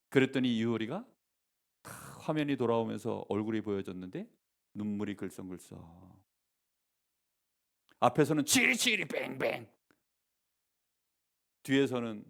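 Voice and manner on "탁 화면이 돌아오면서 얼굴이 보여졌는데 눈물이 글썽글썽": normal talking voice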